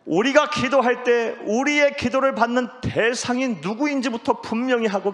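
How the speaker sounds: loud, high-pitched pulpit exhortation; preacher raising the voice high; emphatic preaching. Real, not acted. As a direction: drive the point home at full force. Preacher drives the main point home.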